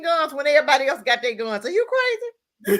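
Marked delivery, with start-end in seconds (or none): 1.7-2.8 laughing disbelief. Real, not acted. Laughing at the mayor's gun talk.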